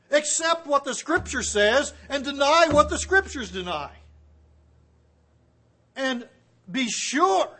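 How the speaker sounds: urgent exhortation. Acted. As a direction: warn the young people not to compromise, urgently. Exhortation to young people.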